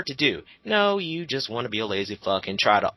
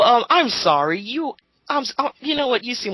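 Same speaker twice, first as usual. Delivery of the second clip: high, flustered put-on outburst; pitched-up indignant mock; exasperated mock reply; 9 semitones higher, 4 dB louder than usual. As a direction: sputter like you're losing patience. Imitating exasperated rant reply.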